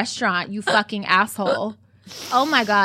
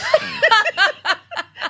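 high, loud burst of laughter; real laughter; 5 dB louder than usual. Real, not acted. Cracking up with friend over family dinner story, high laughter.

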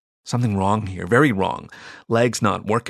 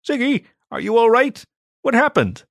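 higher, louder anxious call; worried alien sibling; 12 semitones higher, 4 dB louder than usual. Acted. Zorg anxiously checks on hurt Ziggy, higher worried call. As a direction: rush to check on him.

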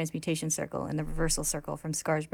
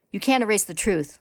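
higher and louder sharp retort; snapping; 4 semitones higher, 6 dB louder than usual. A narrator's reading. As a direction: snap it back hard. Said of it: "Ling snaps".

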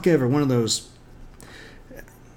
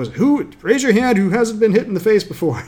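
higher, louder hammed-up barking; mock drill-sergeant shout; 9 semitones higher, 6 dB louder than usual. The sensei questions his students, in a mock drill-sergeant shout. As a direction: bark it at the class like a tough coach. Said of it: "And everyone's like".

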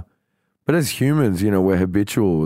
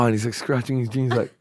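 ordinary delivery

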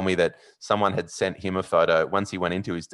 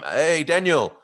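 high, raised call up to a balcony; retold yell; 9 semitones higher, 4 dB louder, slower than usual. Fan hailing a comedian twelve storeys up, yelling. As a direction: holler the name to get attention. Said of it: "this voice yells back".

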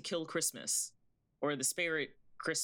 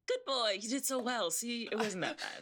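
high sing-song pet praise; praising a dog; 7 semitones higher than usual. Acted. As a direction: coo at your good dog.

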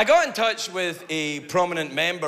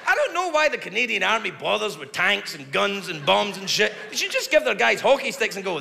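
usual speaking voice

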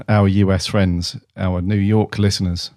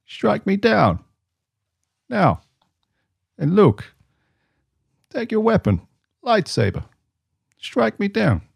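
high, strained Emperor rasp; Walken as the Emperor; 12 semitones higher, slower than usual. Retold or imitated. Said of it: "Emperor Walken".

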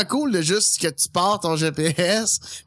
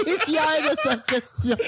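laughing; 8 semitones higher than usual. Real, not acted. Teasing while laughing.

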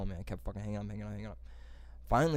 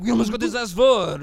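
mock scammer voice; 11 semitones higher, 12 dB louder than usual. Imagined scammer buddy in tent.

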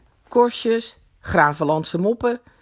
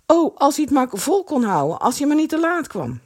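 high, desperate wishing; fretful, urgent exclamation; anxious urgency; 7 semitones higher than usual. A narrator's reading. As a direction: plead anxiously at raised pitch. Kees fears being too late.

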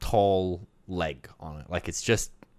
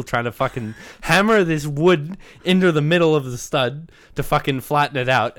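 ordinary talk, nothing marked

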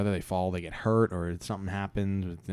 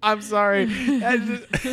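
high, loud laughter breaking through; can't stop laughing; 6 dB louder, much slower than usual. Real, not acted. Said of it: "It just made me laugh".

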